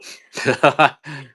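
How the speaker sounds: short, loud high laugh; burst of laughter. Real, not acted. Laughs after joking about wife.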